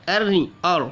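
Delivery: wrong then right अर; thin then full r. Shown as a demonstration. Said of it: "रॉ को पूर्व पढ़ना है".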